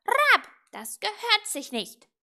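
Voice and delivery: loud, high scolding squawk; reproachful raven. A narrator's reading. Raven to little witch after her prank, reproachful. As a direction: croak a stern reproach. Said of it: "sagte er tadelnd".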